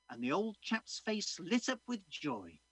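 ordinary talk

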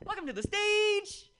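high, loud, slow emcee intro; host announcement. Real, not acted. Emcee hyping the next comic, loud and drawn out. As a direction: stretch the name out for the crowd.

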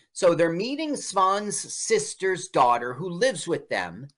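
ordinary delivery

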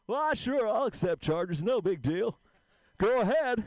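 put-on a mild old vaudevillian croak; George Burns impression. [name] accepting Jeffrey's collect call, as a light old George Burns. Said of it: "sounds like George Burns".

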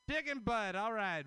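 ordinary talk, nothing marked

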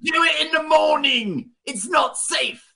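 shouting down the rescue plea; mock shout. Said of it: "Granville's like".

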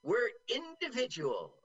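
slow, proud quote of a friend; quoting a friend. Retold or imitated. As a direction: say it like a proud teen. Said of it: "So he said".

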